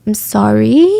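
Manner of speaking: "sorry" rising like a question; rehearsed apology. Retold or imitated. Suspect testing versions of sorry, questioning rise. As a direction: swing "sorry" sharply up at the end. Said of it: "overly analyzing their own cadence and tone".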